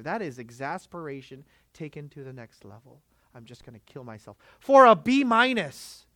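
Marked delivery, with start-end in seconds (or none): 3.3-6.1 high, loud exasperated cry; despairing student. Acted. Student despairing over a B minus, loud and high. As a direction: burst out in exasperation.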